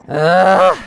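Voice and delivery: loud frustrated "ugh"; strained groan. Acted. Strained effort in story.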